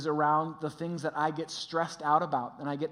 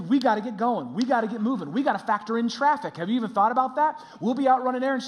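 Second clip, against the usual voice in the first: louder, higher, insistent hurry-up; harried, raised-voice nagging; stressed urgency; 5 semitones higher, 6 dB louder, faster than usual. Retold or imitated. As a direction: press them to get moving, worked up. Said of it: "I'm getting all stressed out".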